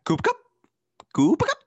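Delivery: squeaky high-pitched name call; high squeal. Real, not acted.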